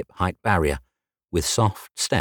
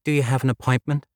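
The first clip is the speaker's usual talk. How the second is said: lightly voiced polite receptionist, higher; a hint of a bright front-desk girl, pitched up; 7 semitones higher, faster than usual. Red-haired receptionist greeting Jay, lightly higher polite voice.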